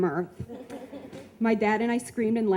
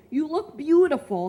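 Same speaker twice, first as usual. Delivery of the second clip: high, louder mock Russian accent; 8 semitones higher, 4 dB louder than usual. Old boyfriend flattering her, mock Russian accent. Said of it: "he said with a Russian accent".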